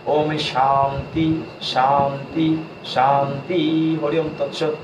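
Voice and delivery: calm, measured Sanskrit prayer; prayer recitation. Real, not acted. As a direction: recite the peace mantra devoutly. (শান্ত স্বরে সংস্কৃত প্রার্থনা পাঠ)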